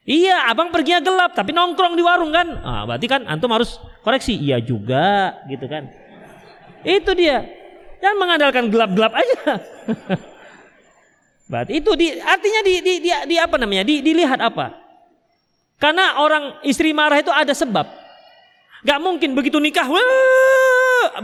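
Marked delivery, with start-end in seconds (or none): 0.1-2.5 mock sarcastic wife. Wife mocks her husband's excuse, sarcastic and higher. 19.9-21.2 mock sudden outburst. Wife suddenly flares up.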